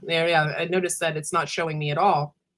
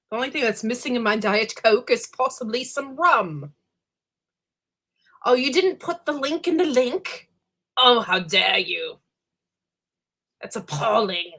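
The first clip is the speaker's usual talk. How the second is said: posh voice; 5 semitones higher than usual. Pretend. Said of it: "When I talk like this".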